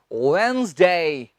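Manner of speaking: वेडनेसडे as slow two-beat WENZ-day, silent D; correct pronunciation. Shown as a demonstration. Teacher models वेडनेसडे for students, careful correct wenz-day with a long glide. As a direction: draw out वेडनेसडे as wenz-daay, falling. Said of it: "ये होता है".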